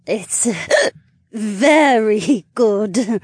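slurring tipsy woman; drunk slur. Acted. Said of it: "she slurred and then giggled".

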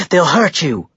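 mildly shouted alarm; shouted warning. A narrator's reading. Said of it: "she shouted".

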